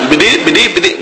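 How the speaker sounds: a quick mock cartoon-pig stammer; Porky Pig stutter. Preacher jokes about his own stumble, mock cartoon-pig stutter. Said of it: "my Porky Pig moment".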